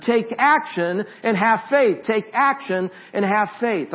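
loud, high chanted slogan; preached refrain. Acted. Pastor drilling the refrain into his congregation, loud and high. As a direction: hammer the refrain home from the pulpit.

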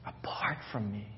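soft unvoiced whisper. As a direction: breathe the repeat as a whisper. Repeat of the line, whispered.

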